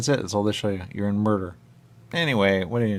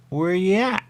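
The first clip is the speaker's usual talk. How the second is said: higher, louder, rising question; high put-on voice; 11 semitones higher, 7 dB louder than usual. Player asks the screen in a high playful voice. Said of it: "And I see it at me".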